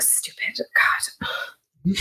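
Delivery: whispered self-reproach, acted; frazzled muttering. Player's character frazzled at the bar, cursing herself in a whisper. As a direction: hiss it under your breath, frazzled. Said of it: "act frazzled and sit at the bar".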